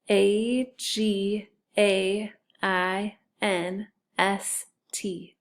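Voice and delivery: very slow, spaced-out letters; spelling aloud. Shown as a demonstration. Teacher leading sight word review, spelling aloud. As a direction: spell it one letter at a time. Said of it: "and spell it".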